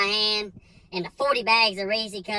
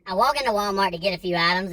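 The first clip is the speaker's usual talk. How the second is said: normal talking voice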